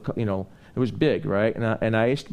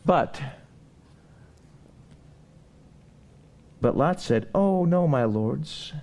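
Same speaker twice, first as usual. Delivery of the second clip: full-force preaching; 8 semitones higher than usual. Acted. Preacher urging the congregation not to linger, fervent. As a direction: drive the sermon home to the congregation.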